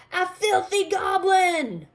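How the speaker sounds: high, loud disgusted farmer yell. A narrator's reading. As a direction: bellow it out in disgust. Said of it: "shrieked the farmer".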